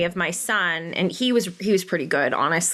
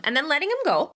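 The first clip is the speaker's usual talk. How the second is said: light high; 8 semitones higher than usual. Real, not acted. Relaxed retelling, raised voice.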